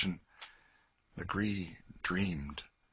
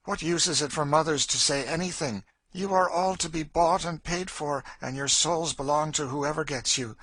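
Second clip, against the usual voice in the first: raised, forceful bitter tirade; bitter Cassie; 7 semitones higher, 5 dB louder than usual. Acted. Woman tells girl they are bought, bitter and forceful. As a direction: drive the hard truth home.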